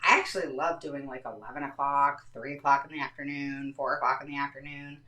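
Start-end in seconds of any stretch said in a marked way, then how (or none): none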